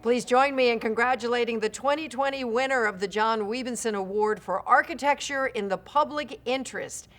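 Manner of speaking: ordinary delivery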